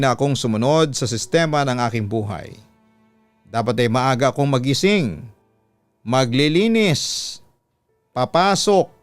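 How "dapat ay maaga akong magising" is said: usual speaking voice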